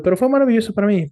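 quick, high and bright with delight; a sudden lift of real excitement; excited. Real, not acted. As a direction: burst out with a rush of joy. Delight at a stock dropping.

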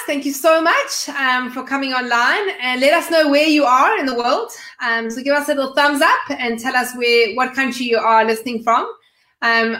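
ordinary talk

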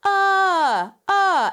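high, loud "Uh, uh"; demo vocal sound. Shown as a demonstration. Coach demos a blend sound for the student. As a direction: sing out a high loud grunt. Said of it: "which is right in the middle".

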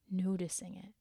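soft unvoiced whisper. Urging the listener to notice, a gentle whisper. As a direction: breathe the words out as a whisper.

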